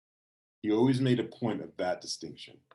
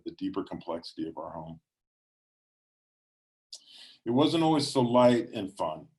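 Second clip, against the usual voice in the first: plain reading voice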